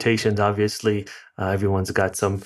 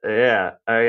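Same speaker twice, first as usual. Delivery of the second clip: usual speaking voice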